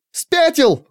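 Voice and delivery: put-on high girlish squeal; shrill squeal. A narrator's reading. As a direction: squeal it out in protest. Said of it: "взвизгнула девчонка".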